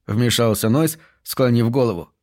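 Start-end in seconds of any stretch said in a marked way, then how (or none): none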